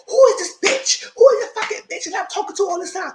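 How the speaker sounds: high, loud, outraged yelling; furious cursing. Retold or imitated. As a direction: rage at the catfish who lied. He rages about the catfish.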